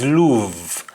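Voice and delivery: "love" said as luuv, with an "oo" vowel; "love" with a long "oo" sound; mistaken spelling pronunciation. Shown as a demonstration. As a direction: say "love" as if spelt with U, luv. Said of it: "it is written with U".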